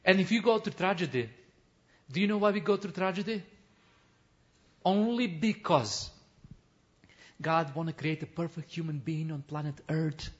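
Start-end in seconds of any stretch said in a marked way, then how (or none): none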